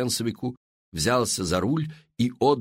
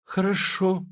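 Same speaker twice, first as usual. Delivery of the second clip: hopeless; 9 semitones higher than usual. A narrator's reading. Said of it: "безнадёжным голосом ответил Артур".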